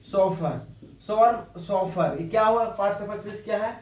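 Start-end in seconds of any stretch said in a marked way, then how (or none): none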